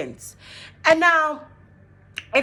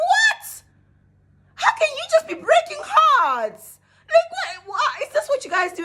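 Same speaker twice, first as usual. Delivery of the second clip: outraged shout; 4 dB louder than usual. Retold or imitated. Retelling her answer to her cheating ex, a high outraged shout. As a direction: shout what like you can't believe it.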